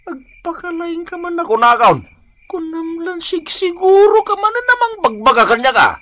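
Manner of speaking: put-on high, defensive old man retort; indignant old man. Old man snapping back at his friend's advice. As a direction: bristle at the lecture.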